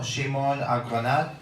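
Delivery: normal talking voice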